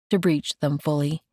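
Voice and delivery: plain reading voice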